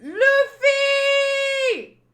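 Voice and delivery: long, high scream of a name; loud, drawn-out cry. Acted. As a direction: scream for help, holding the last vowel. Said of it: "And then someone screams".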